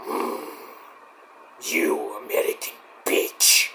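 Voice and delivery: low, slow hissed threat in a breath; menacing whisper. Retold or imitated. Wrestler whispers a threat to her rival, menacing and slow. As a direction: whisper it like a creepy villain. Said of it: "Wendy's like".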